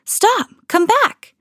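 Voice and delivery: high yell to stop them. A narrator's reading. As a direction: shout after the runaway pudding. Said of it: "so I yelled".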